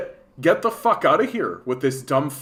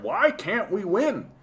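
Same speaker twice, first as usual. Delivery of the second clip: imitating a confused conservative griping; mock conservative voice; 6 semitones higher than usual. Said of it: "conservatives who are like".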